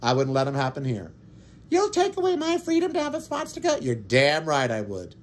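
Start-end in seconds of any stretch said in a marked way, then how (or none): 1.7-3.8 high, whiny mock voice of an outraged flag waver; mocking the swastika defender's protest; mocking whine. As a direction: squeak it out like an indignant opponent.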